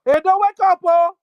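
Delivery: loud rallying cry; loud call to wake up. Real, not acted. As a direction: shout it to rouse the people of Edo. Calling out to Edo.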